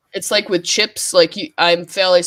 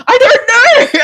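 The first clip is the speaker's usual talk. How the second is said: high, loud laugh through the words; 9 dB louder, slower than usual. Real, not acted. Asked what Aussies should say, laughing helplessly. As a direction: giggle the answer out.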